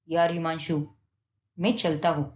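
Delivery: friend's quiet voice. Acted. Awkward friend wants to leave.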